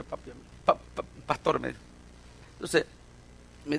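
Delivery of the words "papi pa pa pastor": imitated stammer over a hard word; halting struggle. Young man struggling to call his pastor papi, halting. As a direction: falter softly on it, as if it will not come out. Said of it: "a veces siente duro decirme".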